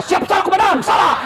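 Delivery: high, loud barked yes-sir; scolded policeman. Retold or imitated. A policeman answering his angry commander with a high loud shout. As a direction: shout the apology like a drilled recruit.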